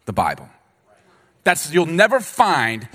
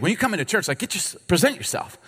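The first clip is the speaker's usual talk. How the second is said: ordinary talk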